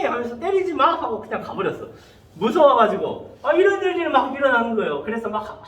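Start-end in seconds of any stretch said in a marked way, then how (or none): 0.4-0.9 high-pitched retold protest; mock big-sister telling-off; scolding older sister. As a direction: step in with a higher voice. Older church girl stops the beating.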